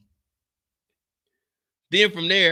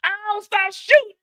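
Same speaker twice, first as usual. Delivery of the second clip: high mock begging; hammed-up high plea to stop shooting; 4 dB louder than usual. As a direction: beg them to stop.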